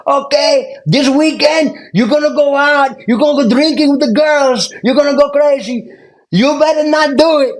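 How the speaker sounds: put-on high, loud Cuban-accented drill instructor rant; Cuban instructor yelling. Cuban drill instructor screaming weekend warnings at recruits. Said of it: "he's screaming at us".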